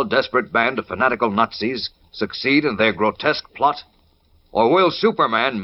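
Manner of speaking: usual speaking voice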